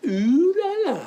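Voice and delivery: high mock-fancy exclamation; ooh la la. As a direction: toss it off in a high, fancy put-on voice. Delighted at getting it free.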